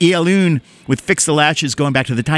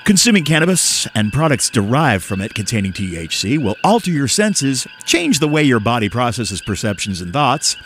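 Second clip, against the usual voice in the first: mock-serious announcer, sinking very low at the end; radio voice; 4 semitones lower than usual. Host warns stoned listeners about driving in radio voice. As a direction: read a solemn PSA, dropping deep for the final phrase. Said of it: "I'm gonna do it in my radio voice".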